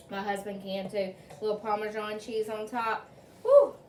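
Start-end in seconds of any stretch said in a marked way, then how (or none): 3.4-3.7 high, loud whoop of delight; excited whoop. Real, not acted. Cook cheering her cheese-topped pasta for viewers, loud high whoop. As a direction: cheer it out, much louder and higher.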